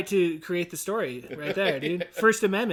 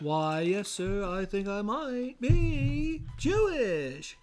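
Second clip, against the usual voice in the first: very slow, soft southern drawl; drawn-out southern comic reply; mock southern drawl; 6 dB quieter, much slower than usual. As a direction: stretch each word out like a southern gentleman. Answering redneck cop in retold joke.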